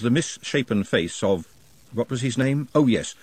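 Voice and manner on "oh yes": usual speaking voice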